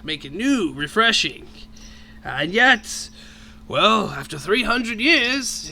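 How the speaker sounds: put-on a jolly old dragon headmaster; booming voice. Dragon headmaster keeps speaking.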